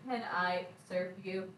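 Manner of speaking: a lightly voiced robotic phone assistant; automated voice. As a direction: say it like an automated Siri voice. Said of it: "like, automated voice".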